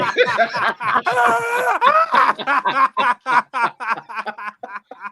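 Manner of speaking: long, loud, high belly laugh; uproarious laughter. Real, not acted. Host cracking up at his own Rastafari joke, uproarious laughter. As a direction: burst out and keep cackling helplessly.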